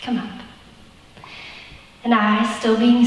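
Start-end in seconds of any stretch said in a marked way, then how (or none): 0.0-0.4 soft, gentle British grandpa; quiet, lightly British invitation; sweet British accent. Acted. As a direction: coax softly in a British accent. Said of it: "in his sweet British accent".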